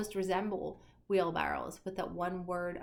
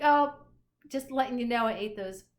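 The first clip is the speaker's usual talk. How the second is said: mock casual note; 4 semitones higher, 9 dB louder than usual. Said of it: "'cause it's just to say, like".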